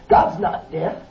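usual speaking voice

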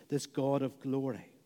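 ordinary talk, nothing marked

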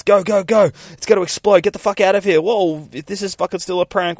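high, loud panicked urging; urgent yelling. Acted. Girls fleeing as the light goes nuts, panicked yelling.

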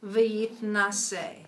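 slow, careful model reading. Shown as a demonstration. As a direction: read it slowly for a learner.

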